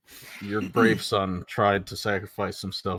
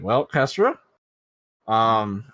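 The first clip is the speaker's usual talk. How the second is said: character voice; 6 semitones higher, much slower than usual. Acted. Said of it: "and be like".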